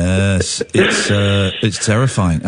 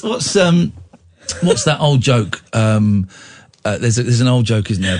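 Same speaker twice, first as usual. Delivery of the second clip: ordinary delivery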